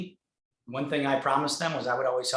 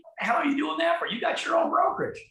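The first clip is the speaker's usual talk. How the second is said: much higher, louder and faster, mock disbelief; mock incredulous friend; 5 dB louder than usual. Friend mocking his choice, in a high incredulous voice. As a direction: play the friend who can't believe it, fast and high. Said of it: "And then he's like".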